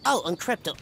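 normal talking voice

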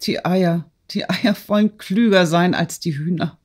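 a touch of tearful emotion, narrated; through tears. A narrator's reading. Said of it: "sagte der Graf unter Tränen".

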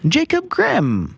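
put-on high, drawn-out name in a made-up Stargate accent; hammed-up Stargate accent; mock Stargate accent. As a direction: stretch the name out in mock Stargate alien speech. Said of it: "These are all in, uh, showery, uh, accents".

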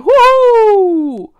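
long, high, very loud whoop; wild hollered "hoo" falling at the end; whooping howl. A narrator's reading. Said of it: "schrie Linus aus vollem Hals". (lang gezogenes Juchzen)

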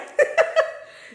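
squealing laugh, high and breathy; high wheezing laugh. Real, not acted. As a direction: burst out laughing.

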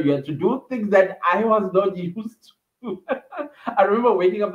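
laughing through the words, pitch climbing; breaking into real laughter. Real, not acted. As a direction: giggle it out in disbelief. Laughs recalling village life.

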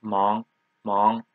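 mang starting low and rising; rising-tone demo of mang; second tone. Shown as a demonstration. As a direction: lift the pitch through each mang. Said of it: "second tone".

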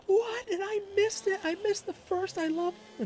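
very high, mock-whiny wife voice; dismayed wife. Wife upset she missed the baby's first words, very high whine.

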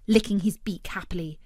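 plain reading voice

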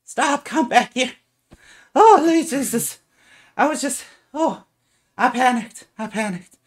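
high loud pleading woman; halfling woman calling back. Acted. Halfling woman calling after the boy, high and loud. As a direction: call him back, high and apologetic. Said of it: "and she's like".

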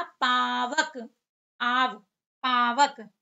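Pavak said very slowly, each syllable stretched; teacher stretching Pavak out syllable by syllable; drawn-out pronunciation. Shown as a demonstration. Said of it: "जब उच्चारण करते हैं".

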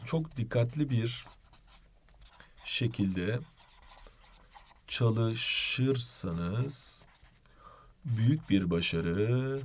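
slow and hushed, drawn out; slow soft dramatic delivery. Pretend. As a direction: soften and slow it like a solemn blessing. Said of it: "Çok teşekkürler hocam". (yavaş ve fısıltıya yakın)

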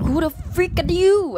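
high, loud, slow burst of alarm; alarmed shout. Retold or imitated. Husband shouting at the mysterious stranger, high and loud. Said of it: "And he's like".

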